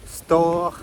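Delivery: usual speaking voice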